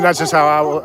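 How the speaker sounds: normal talking voice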